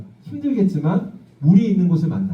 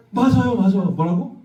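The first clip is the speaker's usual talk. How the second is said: ordinary talk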